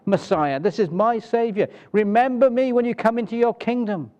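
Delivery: the dying thief crying out his faith; thief's fervent confession. Acted. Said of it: "He says".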